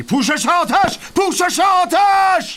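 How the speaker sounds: full-force high yell, drawn out; battlefield shout. Acted. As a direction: scream the order over gunfire. Battlefield order under fire.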